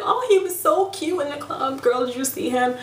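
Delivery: put-on high-pitched girly gossip gush; gushing to girlfriend. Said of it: "You could be like".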